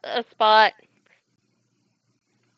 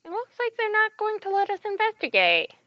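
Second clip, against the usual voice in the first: high character voice; 9 semitones higher than usual. Acted. Voicing a character's line.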